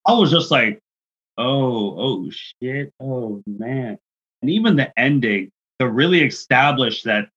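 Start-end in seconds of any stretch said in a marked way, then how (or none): 1.4-4.0 soft, sinking dismay, acted out; hushed stunned reaction. Fan reliving the chair spot, hushed stunned reaction. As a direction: let it drop away in mock disbelief.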